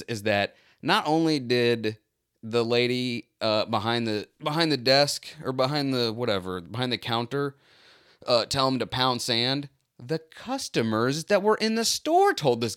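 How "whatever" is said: ordinary talk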